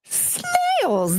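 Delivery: snails shrieked very high; disgusted squeal. Real, not acted. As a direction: squeal the word in mock horror. Mocking snail eaters.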